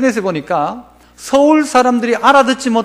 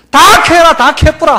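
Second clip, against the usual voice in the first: loud dialect 캐라 and 캐뿌라; shouted Gyeongsang exhortation; 5 semitones higher, 5 dB louder than usual. Acted. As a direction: punch the dialect command out.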